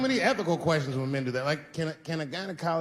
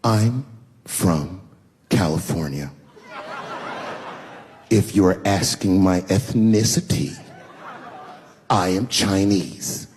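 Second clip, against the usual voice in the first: drawn-out, lower voice, very condescending; slow, lower mock of a snooty wife; 8 semitones lower, 7 dB louder, slower than usual. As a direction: talk down to him, word by word. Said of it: "She goes, very condescendingly".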